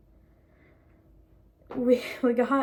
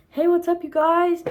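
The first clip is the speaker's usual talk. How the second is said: higher, louder mock greeting; imitating Colby; 6 semitones higher, 6 dB louder than usual. Fan recalling Colby's voice for viewers, imitated greeting. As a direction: call out a YouTuber-style hello, imitating. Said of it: "I can hear Colby's voice right now".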